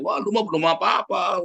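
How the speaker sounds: a high, dismissive one-upper; one-upping friend. Retold or imitated. A friend tops another's misfortune, with high dismissive scorn. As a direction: wave off his bad luck as nothing.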